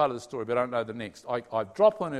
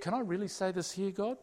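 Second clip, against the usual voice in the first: ordinary talk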